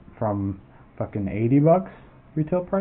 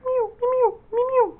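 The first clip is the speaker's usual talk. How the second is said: high, squeaky cat meows; mock meowing. Taunting the hit players with meows, squeaky and high. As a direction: meow like a cat to taunt them. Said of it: "you could just go".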